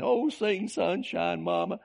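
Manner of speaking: put-on high, drawn-out little-kid Okie twang; funny Okie accent. Grown man replays his childhood lullaby request, high Okie-accented kid voice. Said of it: "I had a funny accent back then".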